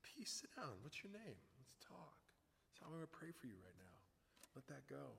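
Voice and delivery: very soft, near-whispered counsel; hushed calming. Retold or imitated. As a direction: talk someone down privately. Retells praying calmly, privately.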